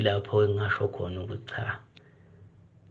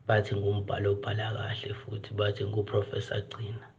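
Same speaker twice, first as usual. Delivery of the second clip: ordinary delivery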